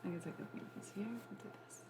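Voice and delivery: fast unvoiced whisper. Real, not acted. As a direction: whisper it quickly. Quick whispered aside.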